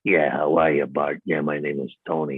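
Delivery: low mob boss voice, quite unlike his own; Fat Tony voice. Retold or imitated. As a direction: drop low and play the gangster. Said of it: "I went like".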